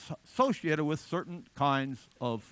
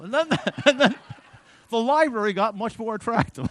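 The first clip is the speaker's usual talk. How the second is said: laughing; 4 semitones higher, 9 dB louder than usual. Real, not acted. Laughing at vodka story.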